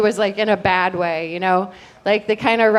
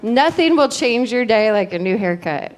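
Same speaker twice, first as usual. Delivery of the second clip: ordinary talk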